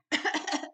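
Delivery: short pointed cough; negative cough. Shown as a demonstration. Showing how they cough in a negative manner, pointed cough. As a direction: cough at them as harassment. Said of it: "In a negative manner".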